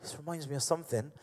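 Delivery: very soft, halting thinking aloud; puzzled musing. Acted. Peter puzzling as fish fill the net, hushed musing. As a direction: trail into a private half-formed thought. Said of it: "and he's like".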